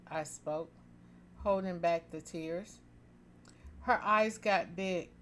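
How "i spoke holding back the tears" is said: usual speaking voice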